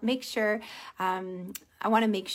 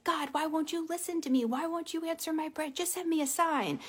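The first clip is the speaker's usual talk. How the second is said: higher, soft mock whine; pleading prayer; 5 semitones higher, 3 dB quieter than usual. Driver asks why God won't answer, pleading. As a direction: plead upward, imitating frustration.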